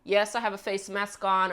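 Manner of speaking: normal talking voice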